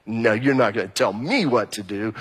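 low, pushing-back defiance; defiant attitude. Acted. Preacher voices his own rebellious streak, defiant attitude. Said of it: "kind of attitude".

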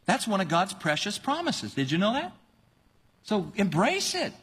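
ordinary delivery

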